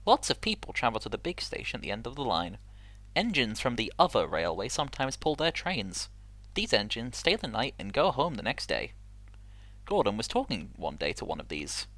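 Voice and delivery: steady storybook narration; story narration. Acted. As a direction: read like a children's storybook. Reads Railway Series story.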